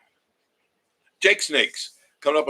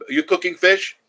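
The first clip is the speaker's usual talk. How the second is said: higher, louder call to the next room; raised voice calling to his wife; 8 semitones higher, 8 dB louder than usual. Real, not acted. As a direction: call across the house to ask.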